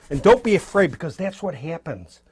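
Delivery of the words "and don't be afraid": louder, punchy pep talk; loud encouragement. Shown as a demonstration. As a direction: push it out like a coach. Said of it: "coaching beginners not to fear".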